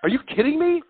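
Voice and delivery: high, loud imitation of the wife; mock disbelief. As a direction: act out her stunned reaction. Retold wife reacting in disbelief.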